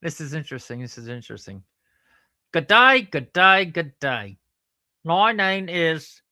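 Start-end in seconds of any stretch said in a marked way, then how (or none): 2.5-6.2 loud, high-starting Australian greeting; attempted Australian accent. Shown as a demonstration. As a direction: have a go at Australian, drawn out at the end. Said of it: "try to do an Australian accent".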